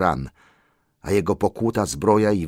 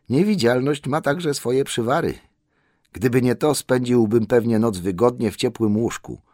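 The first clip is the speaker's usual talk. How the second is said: slightly put-on, higher-pitched hobbit; higher voice, grumbling to oneself; lightly voiced Bilbo, grumbling; 6 semitones higher, 3 dB louder than usual. Hobbit rues his invisibility, lightly voiced grumble.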